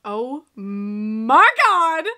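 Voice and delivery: very slow, drawn-out gasp of disbelief; shocked. Real, not acted. Viewer reacting to a twist in the film, shocked. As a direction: stretch each word out in shock.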